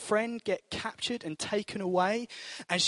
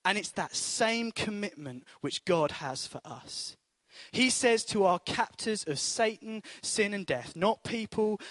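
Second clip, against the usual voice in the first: ordinary talk, nothing marked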